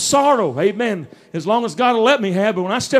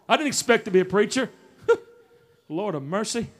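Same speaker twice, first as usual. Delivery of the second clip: usual speaking voice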